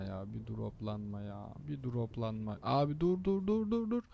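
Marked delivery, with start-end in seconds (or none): 2.6-4.0 rising, much higher, strained cries of dur; climbing yell of alarm at the truck; panicked yell. Real, not acted. Driver yells at truck braking suddenly ahead, panicked rising cry.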